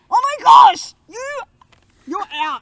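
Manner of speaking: loud, high playful outburst; mock outraged shout. Real, not acted. Wife jokingly yelling at husband, loud and high.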